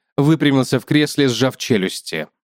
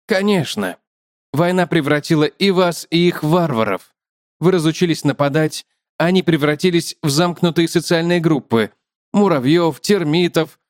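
higher-pitched spider character voice; chatty spider, lifted above the narration; talkative spider; 4 semitones higher than usual. Acted. Spider explains its kind to the man, higher chatty voice.

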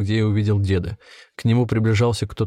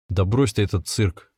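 usual speaking voice

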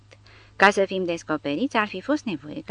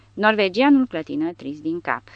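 ordinary delivery

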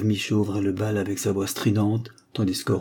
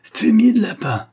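furious roar, much higher and louder; farmer's enraged outburst; 13 semitones higher, 8 dB louder than usual. Acted. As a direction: bellow it at the ravers. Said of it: "rugit Robert".

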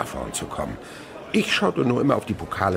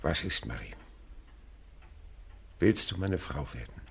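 tender proposal; 8 dB quieter than usual. Real, not acted. Old man proposing to housekeeper.

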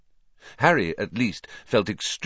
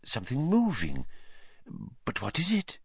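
softer, high mysterious wondering; hushed seer; 6 semitones higher, 6 dB quieter than usual. A narrator's reading. Trelawney peering into the ball, soft and mysterious. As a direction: murmur like seeing a vision.

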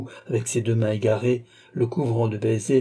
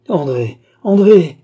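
loud call, far higher and louder on the repeat; desperate cry; 6 semitones higher, 11 dB louder, slower than usual. A narrator's reading. Sobbing brother holding his sister, desperate cry. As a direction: cry out the name in anguish. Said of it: "s'écria-t-il".

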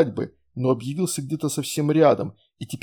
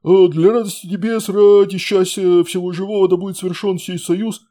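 gabbling; 6 semitones higher, 8 dB louder than usual. A narrator's reading. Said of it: "затараторил тот".

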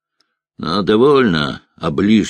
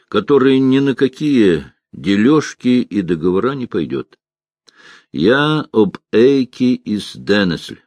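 ordinary delivery, nothing marked

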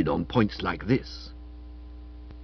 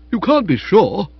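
protesting; 8 semitones higher, 7 dB louder than usual. Acted. Percy protests.

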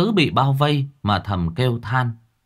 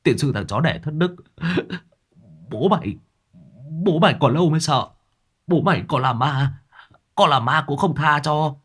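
higher-pitched spluttering foul-mouthed victim; defiant cursing; 5 semitones higher than usual. Acted. Struggling captive cursing the ghostly sorcerer, defiant. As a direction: swear back at your captor, struggling. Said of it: "Long Tứ giãy giụa chửi thề".

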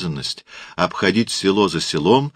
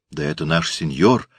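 plain reading voice

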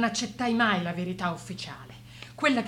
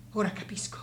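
whispered realization. Acted. Sudden private realization.

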